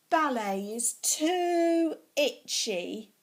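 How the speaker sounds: loud, slow, whiny complaint; a bit loud. Acted. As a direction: moan it loudly, dragging it out. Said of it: "But I was a bit loud".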